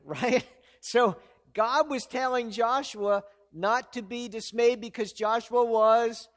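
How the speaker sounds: ordinary talk, nothing marked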